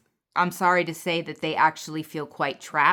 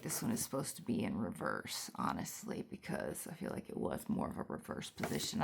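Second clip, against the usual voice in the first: muttered aside; 11 semitones lower, 13 dB quieter, faster than usual. Real, not acted. Muttered aside about the card.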